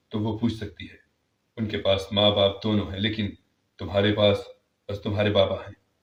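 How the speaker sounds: steady a hurt daughter; consoling father. Acted. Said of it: "پر بابا نے کہا".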